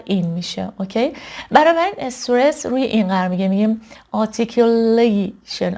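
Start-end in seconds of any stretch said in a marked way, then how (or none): 4.1-5.8 articulation with LAY heavily drawn out; articulation, next-to-last syllable exaggerated; exaggerated stress. Shown as a demonstration. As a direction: slowly overdo the stress on the "la" of articulation.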